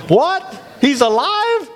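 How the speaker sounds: high-pitched, baffled questioning; incredulous governor. Retold or imitated. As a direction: act out a ruler who can't believe it. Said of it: "imagine the governor saying".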